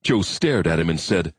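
ordinary delivery

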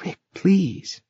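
high, frightened plea; fearful plea. A narrator's reading. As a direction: beg him softly, afraid. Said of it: "trembled with worry and fear".

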